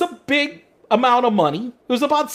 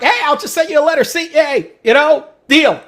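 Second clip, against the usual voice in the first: louder, fast, hammed-up careless deal talk; mock brash dealmaker; 4 semitones higher, 6 dB louder than usual. Mocking a brash negotiating style, louder and fast. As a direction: toss the deal off like a cocky tycoon. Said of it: "the Donald Trump negotiating tactic".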